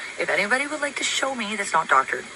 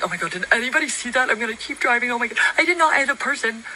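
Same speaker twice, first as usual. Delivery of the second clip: high, loud mock panic; mocking panicked voice; 7 semitones higher, 3 dB louder than usual. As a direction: ham up a fleeing driver's panic. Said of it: "You would've just been like".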